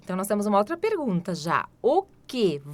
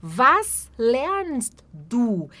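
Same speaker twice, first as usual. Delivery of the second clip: "Was trinkt sie?" drawn out very slowly; very slow demo; 3 semitones higher, 4 dB louder, much slower than usual. Shown as a demonstration. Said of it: "teacher models question".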